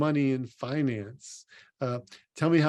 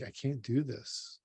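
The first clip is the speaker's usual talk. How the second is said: drained despair; 3 semitones lower, 8 dB quieter than usual. Retold or imitated. A person crying into the pillow at night, voicing drained, quiet despair. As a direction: let it sink to a near-murmur of exhaustion. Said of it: "And you just feel like".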